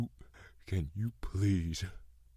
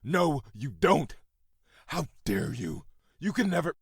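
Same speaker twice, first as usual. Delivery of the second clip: higher, louder, indignant fury; angry loud retort; 7 semitones higher, 5 dB louder than usual. Acted. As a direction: snap back in outrage. Father rejects son's sympathy.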